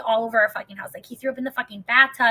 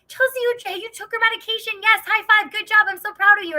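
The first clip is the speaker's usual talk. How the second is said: much higher and louder, sing-song praise; praising a child; 11 semitones higher, 5 dB louder than usual. Retold or imitated. Husband rewarding his wife like a little child, much higher. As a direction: overpraise her like a kindergartner. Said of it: "he'd be like".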